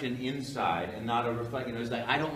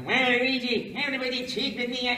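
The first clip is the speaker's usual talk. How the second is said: very high cartoon character voice; cartoon fool; 10 semitones higher, 6 dB louder than usual. Fool complaining to Luigi, high cartoon voice. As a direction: whine comically far above your own pitch.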